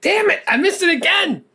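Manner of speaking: high, laughing frustration; playfully annoyed at himself; mock exasperated. Real, not acted.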